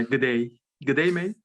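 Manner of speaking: playful attempt at an Aussie greeting; Australian accent. Podcast host teasing co-host about a funny Aussie, mock Australian accent. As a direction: try on an Australian accent, lilting up at the end. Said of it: "trying an Amer-, Australian accent".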